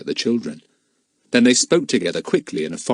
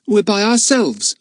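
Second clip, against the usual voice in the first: put-on child's voice; 12 semitones higher, 4 dB louder than usual. A narrator's reading. Philip, a child, answers.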